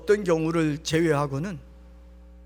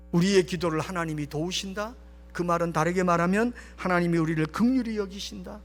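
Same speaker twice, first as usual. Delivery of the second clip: usual speaking voice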